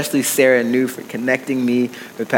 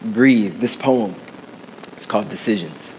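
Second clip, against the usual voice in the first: rhythmic spoken word, building intensity; spoken word poetry; 3 semitones higher, 3 dB louder than usual. Acted. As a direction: perform it like a slam poem. Spoken word poem performance.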